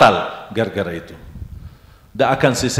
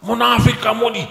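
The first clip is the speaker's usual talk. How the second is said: mock angry insult; 11 semitones higher, 3 dB louder than usual. Orang marah memaki.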